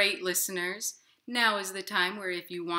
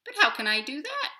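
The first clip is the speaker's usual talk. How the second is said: fast, higher worried little voice; puzzled inchworm; 9 semitones higher, 8 dB louder, faster than usual. Acted. Inchworm anxiously asking the nightingale how, fast and high. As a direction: fret quickly. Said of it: "said the inchworm".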